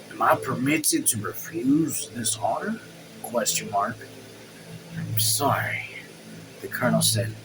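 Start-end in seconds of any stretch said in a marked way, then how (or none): 0.1-2.8 soft, lowered voice, a touch weary; softly. A narrator's reading. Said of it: "Ordine said softly". 5.0-5.9 breathy whispered apology. A narrator's reading. Colonel apologizes to the mayor.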